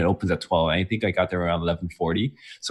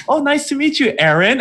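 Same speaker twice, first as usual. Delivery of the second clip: much higher, chirpy mock greeting; mock friendly greeting; 5 dB louder than usual. Imitating people at the fair, a chirpy high greeting. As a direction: sing out the greeting brightly. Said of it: "they're like".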